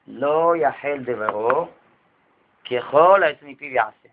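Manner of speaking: slow, low, word-by-word verse recitation; solemn scripture recital. Real, not acted. Rabbi quoting the verse to congregants, slow and solemn. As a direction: intone the Torah verse with weight. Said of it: "כתוב בתורה".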